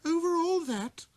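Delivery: very high, loud astonished question; stunned at the scale of it; little prince, amazed, looking at the stars. A narrator's reading. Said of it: "asked the little prince".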